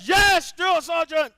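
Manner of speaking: high, loud recruit shout; barked military recruit reply. Acted. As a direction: shout back at the sergeant. Louder on command.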